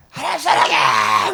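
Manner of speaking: slow, high, loud crying cry of love to God; tearful shouted prayer. Retold or imitated. Preacher reenacting her shouted prayer in the car, crying out. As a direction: shout it like a prayer breaking into tears. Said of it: "막 이러면서".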